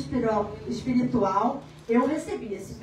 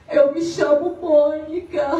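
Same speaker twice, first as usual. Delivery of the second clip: louder and slow, words breaking into a sob; through tears; 3 semitones higher, 7 dB louder, slower than usual. Retold or imitated. A grieving mother tells her name to the stranger, sobbing. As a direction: say your name while weeping. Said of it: "ela chorando em lágrimas".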